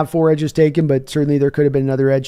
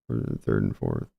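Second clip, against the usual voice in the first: deep mock voice; 9 dB quieter than usual. Repeating a viewer's trade option, mock deep voice. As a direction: drop far lower and darker for a joke.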